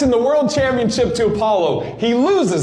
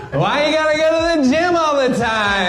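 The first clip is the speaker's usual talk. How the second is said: high, whiny nagging complaints; nagging girlfriend; 4 semitones higher than usual. Retold or imitated. Adrian nagging Rocky about the gym, whiny and high. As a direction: nag him like a fed-up girlfriend. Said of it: "Adrian just nagging him".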